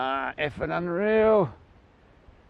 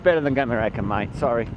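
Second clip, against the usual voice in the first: ordinary talk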